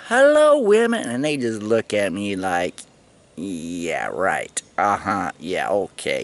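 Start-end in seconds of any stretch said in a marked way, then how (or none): 0.1-1.1 loud, high flirty hello; calling out. Retold or imitated. Greeting the passing women, in a loud high flirty call. 3.3-6.2 lower, softer sarcastic brush-off; dismissive mock reply. The women brushing him off, in a low soft sarcastic tone.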